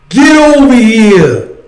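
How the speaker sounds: put-on slow, high New York accent. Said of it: "That's like the worst New York accent ever".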